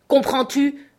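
sudden high, loud burst of anger; angry shout. A narrator's reading. Scott demanding that Beauty Smith understand, shouting angrily. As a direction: shout it in a flash of temper. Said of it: "cria Scott dans un accès soudain de colère".

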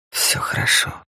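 тихое, почти шёпотом утешение; успокой плачущую жену мягким полушёпотом. Acted. Муж утешает плачущую жену. (успокаивающе, вполголоса)

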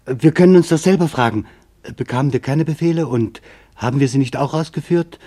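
ordinary delivery

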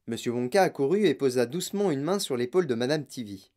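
plain reading voice

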